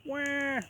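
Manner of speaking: put-on high, wailing baby cry; shrill infant "wah". A narrator's reading. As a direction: wail like a hungry newborn. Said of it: "yelled the baby ghost".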